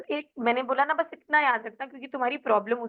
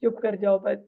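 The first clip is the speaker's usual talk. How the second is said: irritated and sleepy. Shown as a demonstration. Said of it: "मतलब irritate हो रहे हो अब कि".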